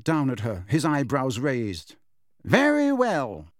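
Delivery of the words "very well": slow, high-starting fall; drawn-out, haughty acceptance; cool concession. A narrator's reading. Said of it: "he said coolly".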